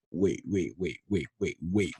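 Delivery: low, hushed string of waits; stunned muttering. Retold or imitated. Storyteller replaying the moment a drink was thrown at them, stunned muttering. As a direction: mutter it low while working it out. Stunned, piecing together what happened.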